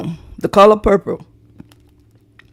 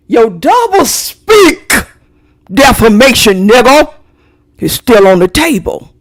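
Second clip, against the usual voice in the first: heated threat; 5 dB louder than usual. Real, not acted. Angry threat at defamer.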